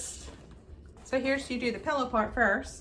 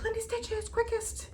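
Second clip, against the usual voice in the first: high, softer mock praise; mock announcer; 11 semitones higher, 6 dB quieter than usual. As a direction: hype them up like an ad. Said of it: "But I was like".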